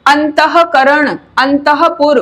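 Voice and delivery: अंतःकरण slow, with a हा after अंत; slow visarga words. Shown as a demonstration. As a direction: breathe हा inside अंतःपुर. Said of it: "वहां हमें हा का उच्चारण करना होता है".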